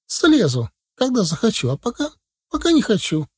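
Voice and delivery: put-on high-pitched stubborn kid; defiant little boy. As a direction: refuse in a high, obstinate child's voice. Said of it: "возразил яростно мальчуган". (упрямый мальчишка, высоким голосом)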